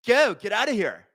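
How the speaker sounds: put-on a Californians-skit brush-off in a SoCal accent. Shooing the chat away in a mock SoCal accent. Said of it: "I'm gonna speak like I'm, I'm from, uh, the hills".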